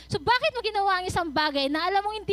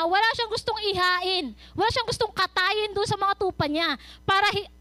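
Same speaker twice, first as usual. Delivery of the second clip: normal talking voice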